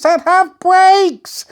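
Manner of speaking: high, slow, worried wail; dawning panic about the brakes; anxious. A narrator's reading. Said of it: "the crocodile cried in an anxious voice".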